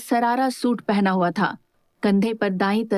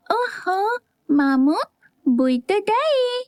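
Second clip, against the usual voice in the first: put-on much higher, slow little boy sigh; exasperated child; 10 semitones higher, much slower than usual. A narrator's reading. Little boy to his uncle as his aunt runs off, mock exasperated. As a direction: groan it like a small boy giving up. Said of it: "अपने सर पर हाथ मारते हुए बोला".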